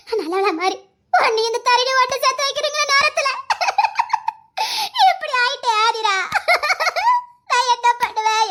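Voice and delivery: put-on keen over the children in grief; wailing lament. Mother wails over injured children. (ஐயோ என்று அழுது ஒப்பாரி வைத்தல்)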